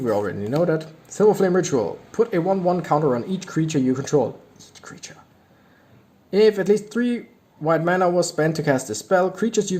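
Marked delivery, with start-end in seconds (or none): 4.6-5.2 whispered. Real, not acted. Awed aside at card effect.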